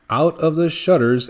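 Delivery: usual speaking voice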